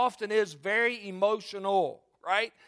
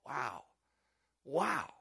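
low, soft wow; hushed awe; 7 semitones lower, 8 dB quieter than usual. Real, not acted. Preacher reflecting on selfless love, hushed awe. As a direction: let it drop quietly, taking it in.

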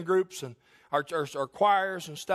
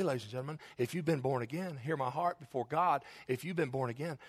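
ordinary delivery

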